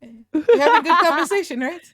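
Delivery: mock the friend chewing in the corner; imitating her voice. Mocking the friend who was chewing, high laughing put-on voice. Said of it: "I make her voice do that".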